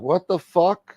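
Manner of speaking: retold indignant disbelief. Man watching the SUV reverse, retold indignant disbelief. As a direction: replay your disbelief at the driver.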